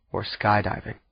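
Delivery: plain reading voice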